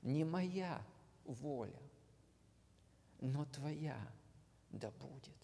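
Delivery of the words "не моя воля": ordinary talk, nothing marked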